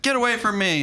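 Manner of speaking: high mock outburst; Tiffany pushing him off. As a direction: raise the pitch, fling it at him. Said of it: "And she's like".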